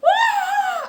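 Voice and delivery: very high, loud sustained wail; imitated tic scream. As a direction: let out a sudden uncontrollable shriek. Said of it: "he just can't control himself".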